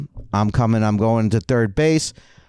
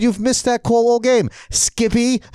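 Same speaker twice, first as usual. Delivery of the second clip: high, indignant protest; retold argument; 9 semitones higher than usual. Player argues with umpire over missed calls, high indignant outburst. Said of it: "That was my argument".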